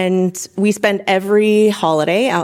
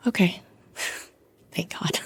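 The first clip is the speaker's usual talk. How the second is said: relieved whisper; 10 dB quieter than usual. Real, not acted. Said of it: "relief after ultrasound".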